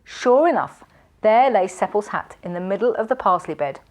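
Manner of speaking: ordinary delivery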